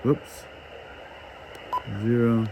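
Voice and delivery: normal talking voice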